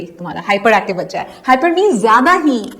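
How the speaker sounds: normal talking voice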